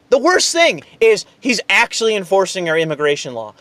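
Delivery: normal talking voice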